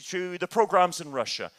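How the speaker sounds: usual speaking voice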